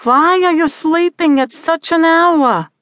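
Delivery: high, loud, scolding mother voice. A narrator's reading. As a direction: nag him like a worried mom.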